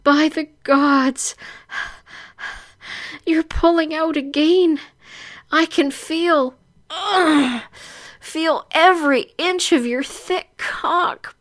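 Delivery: high, strained, panting voice; distressed heroine. Acted. Bound heroine protesting to her captor, high and breathless.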